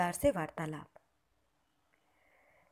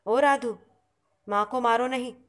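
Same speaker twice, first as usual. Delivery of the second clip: a firm call across the house, louder; monk calling Radhu to stop hitting Mother, raised voice; 3 semitones higher, 6 dB louder than usual. A narrator's reading. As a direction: call the girl off from the next room.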